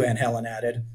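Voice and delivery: usual speaking voice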